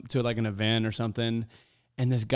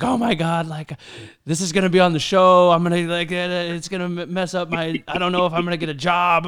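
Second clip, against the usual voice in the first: higher, louder, fast panicked babble; rattled panic; 5 semitones higher, 6 dB louder than usual. Retold or imitated. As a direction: act out a rattled fan, voice rising in panic.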